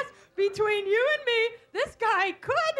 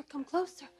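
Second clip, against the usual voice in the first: much softer, secret-sharing; hushed; 5 semitones lower, 9 dB quieter than usual. Acted. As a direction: drop to a near whisper. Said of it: "come closer, secret".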